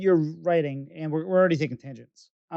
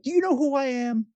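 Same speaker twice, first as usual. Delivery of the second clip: higher, haughty put-on celebrity voice; mock arrogant; 7 semitones higher than usual. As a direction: play the offended star, tongue in cheek. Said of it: "You'd be like".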